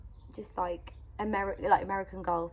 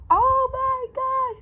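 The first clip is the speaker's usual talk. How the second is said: very high, loud, stretched gasp of wonder; amazed child; 12 dB louder, much slower than usual. Retold or imitated. Mum imitating her son seeing the golf, squealing and amazed. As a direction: play a kid blown away by the surprise.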